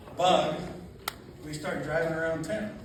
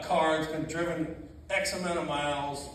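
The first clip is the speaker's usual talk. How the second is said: usual speaking voice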